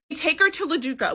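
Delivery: ordinary talk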